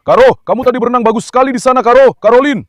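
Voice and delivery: high, fast and loud praise for the dog; excited praise. Real, not acted. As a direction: gush over the dog after her swim. Greeting dog after swim.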